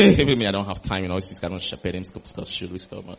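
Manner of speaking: low mumble, fading away; muttered brush-off. Acted. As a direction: grumble excuses under your breath while hurrying off. Acting a shepherd who has no time.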